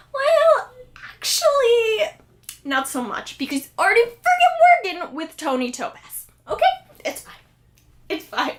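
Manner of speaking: exasperated sarcasm, high and loud at the start then dropping flat; fed up with Jughead, sarcastic rant that sinks to a mutter; sarcastic retort. Retold or imitated. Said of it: "And I was like".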